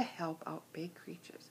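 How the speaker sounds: soft whisper at the end; whispered moral. Acted. Teller closes the story for children, whispering. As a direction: breathe the moral out like a secret.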